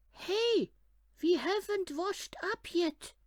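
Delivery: high, loud sudden exclamation; excited kid. A narrator's reading. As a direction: shout out a sudden reminder. Rabbit kid calls out.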